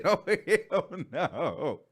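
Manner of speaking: slow, laughing refusal; laughing "oh hell no". Retold or imitated. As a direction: draw it out through a laugh. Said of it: "She said".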